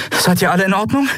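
high, rising question; concerned. A narrator's reading. Endriel asks the crew on the bridge, concerned.

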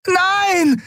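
very high, desperate cry. A narrator's reading. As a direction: call out high in horror. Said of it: "rief Andriel".

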